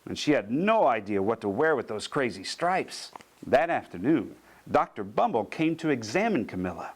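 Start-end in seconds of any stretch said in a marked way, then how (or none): none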